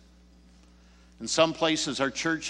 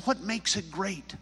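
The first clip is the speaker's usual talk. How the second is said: higher-pitched quick query; curious question; 7 semitones higher than usual. Retold or imitated. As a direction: ask it lightly, pitched up. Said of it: "And I said".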